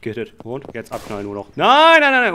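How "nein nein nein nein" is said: high, loud repeated "nein"; panicked shouting. Real, not acted. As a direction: shout in panic as they catch you. Cops are catching him. (panisches, lautes Rufen)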